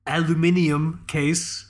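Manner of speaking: British pronunciation, al-yoo-MIN-ee-um, drawn out; stretched British five-syllable aluminium; British accent. Acted. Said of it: "say with a British accent".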